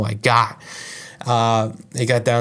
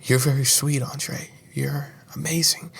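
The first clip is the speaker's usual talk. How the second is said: soft whisper; 3 semitones higher, 4 dB quieter than usual. Retold or imitated. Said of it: "she's just like".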